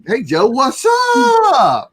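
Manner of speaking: high, drawn-out holler; goofy stretched-out shout hello; playful shouted greeting. Pretend. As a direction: call it out long and high to a pal. Host playfully hails her guest.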